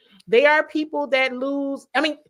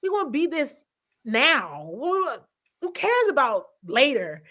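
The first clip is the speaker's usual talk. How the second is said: higher, fired-up insistence; hyped-up coach; 6 semitones higher than usual. Retold or imitated. Coach rejecting the wait-till-later talk, fired up and higher. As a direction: push back with urgency. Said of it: "I can feel him getting hype".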